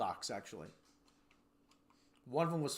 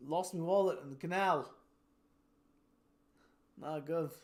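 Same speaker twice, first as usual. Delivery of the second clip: hammed-up Monty Python British accent; mock British accent; 2 semitones higher, slower than usual. Local telling of a wallet lost in the canal, mock British accent. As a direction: play a British local from a comedy sketch. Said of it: "like walking around in a Monty Python movie".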